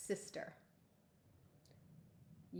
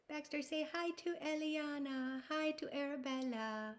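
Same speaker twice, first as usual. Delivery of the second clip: high, soft puppet voice for Baxter; 7 semitones higher, 3 dB quieter than usual. Pretend. Teacher voicing a puppet to greet two girls, softly. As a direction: make the puppet greet the kids.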